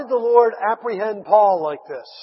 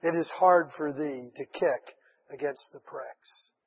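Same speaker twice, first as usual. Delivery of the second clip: lower, softer and slow, solemn reply; calm voice of the Lord; 5 semitones lower, 6 dB quieter, slower than usual. Acted. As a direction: answer gently from above. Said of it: "the Lord said".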